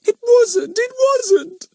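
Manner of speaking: very high, loud tearful denial; sobbing child protest. A narrator's reading. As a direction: wail it like a small girl. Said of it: "sobbed Bets".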